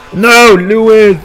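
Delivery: anguished. Real, not acted. Teammate dies in game.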